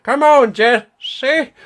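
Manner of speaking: high coaxing call to the dog; high sing-song call to the dog. Retold or imitated. Calling the dog in a voice.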